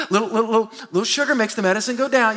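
usual speaking voice

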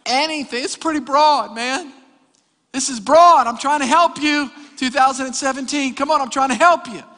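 loud, high, insistent exhortation; forceful preaching. Real, not acted. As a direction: press it on the congregation at full voice. Preaches forcefully, urging the congregation.

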